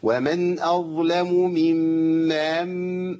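ممن stretched with an added vowel between the مims; wrong mims. Shown as a demonstration. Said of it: "ما تعملش كده". (مد خاطئ بين الميمين)

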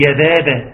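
mock-correct يا بابا as thin "bebe"; light ba. Shown as a demonstration. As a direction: front the vowels of يا بابا like a child obeying the rule. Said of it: "ترقيق الباء". (يا بابا بباء مرققة)

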